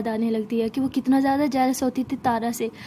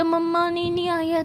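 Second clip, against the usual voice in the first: put-on higher, drowsy child whine; sleepy little cousin; 4 semitones higher than usual. Little cousin answers Sara from the washroom, sleepy child voice. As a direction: stretch it out like a sleepy toddler. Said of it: "नन्हा सा cousin जो है वो ऐसे नींद में".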